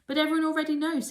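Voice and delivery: high, loud, fast; eager. A narrator's reading. Maud telling Mildred everyone knows: high fast eager voice. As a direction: rush it out eagerly.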